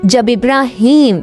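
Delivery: plain reading voice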